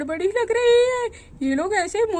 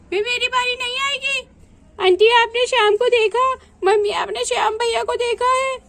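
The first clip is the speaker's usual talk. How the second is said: put-on high childish voices; children playing; 6 semitones higher than usual. Children call to each other over whose turn it is, high childish voices. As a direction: play the kids squabbling over turns.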